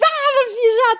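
high, loud happy squeal; excited squeal. Retold or imitated. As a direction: squeal with travel joy. Retold joy at trip.

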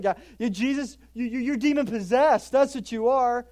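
loud, sputtering accusing voice; hammed-up angry accusers; mock accusation. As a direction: jab the words out in outrage. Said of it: "Now they're saying".

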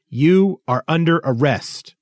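high, slow declaration; calling out. A narrator's reading. Thistle arresting the masked stranger, calling out slowly. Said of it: "he called".

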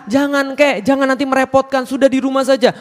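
high-pitched protest to an elder; pleading objection. Acted. Younger man urging grandpa to stay home, high pleading. Acted plea to old man.

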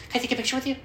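high, nervous mock fanboy voice; awkward fan. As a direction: pipe it up, timidly asking your idol. Acts awkward fan asking for picture.

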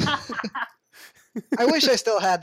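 slow, laughing speech. Real, not acted. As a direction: laugh as you say it. Laughing while talking.

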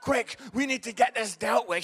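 loud, urgent mock panic; panicking child actor. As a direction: imitate a flustered kid taking charge. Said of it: "He's really panicking".

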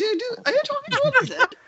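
Amy voice. Acted. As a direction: lean harder into the Amy accent, pitch up. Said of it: "is this your Amy voice".

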